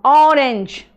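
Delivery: normal talking voice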